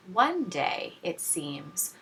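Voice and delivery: usual speaking voice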